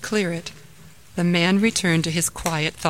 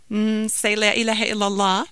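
put-on child's tentative guess, higher; 4 semitones higher than usual. Daughter guesses the answer to mother's question, guessing. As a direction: venture an answer to mom. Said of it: "guessed Jamila".

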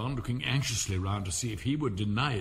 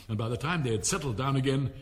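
usual speaking voice

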